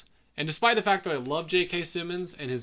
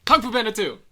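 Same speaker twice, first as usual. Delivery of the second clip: raised, loud announcer call; title announcement; 9 semitones higher, 9 dB louder than usual. Pretend. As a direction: announce the film title with punch. Announcing the episode's film title.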